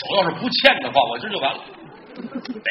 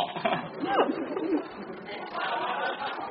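voiceless wheezing chuckle, much softer; soft breathy laugh; 7 semitones higher, 8 dB quieter than usual. Acted. Fake ghost wheezes with laughter, soft and voiceless.

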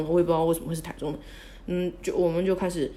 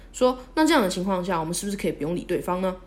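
normal talking voice

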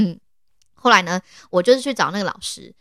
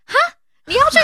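high, fast astonished repeat-back; repeated exclamation; 5 dB louder, faster than usual. Retold or imitated. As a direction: echo her words in total disbelief. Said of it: "连续惊呼".

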